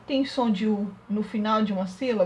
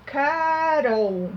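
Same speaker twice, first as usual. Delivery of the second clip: drawn out; 3 semitones higher than usual. Shown as a demonstration. Teacher sounding out the answer slowly.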